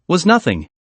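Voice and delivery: ordinary delivery, nothing marked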